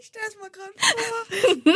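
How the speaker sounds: mit Lachen in der Stimme; lachend. Real, not acted. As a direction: vor Lachen fast weinend. Stellt sich lachend Szene vor.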